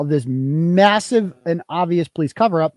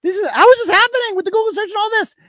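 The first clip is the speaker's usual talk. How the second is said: much higher, fast, disbelieving cry; a pitched-up what-the-hell rant; incredulous outburst; 12 semitones higher, faster than usual. Retold or imitated. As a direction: throw up your hands in disbelief. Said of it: "It's like".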